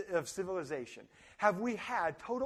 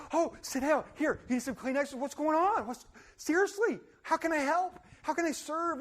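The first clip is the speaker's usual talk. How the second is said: higher, caring mock concern; 7 semitones higher than usual. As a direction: soothe the upset student. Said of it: "I'm like".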